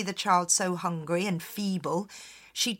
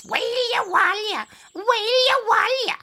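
high, far wailing changeling cry; eerie high changeling baby wail; 13 semitones higher, 5 dB louder than usual. Shown as a demonstration. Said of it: "It was more like this".

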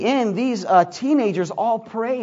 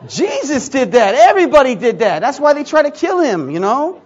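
louder and higher, forceful preaching at them; heated retort; 5 semitones higher, 7 dB louder than usual. Retold or imitated. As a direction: hammer the point home to the boys. Retold heated reply to group.

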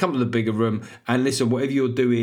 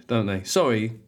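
normal talking voice

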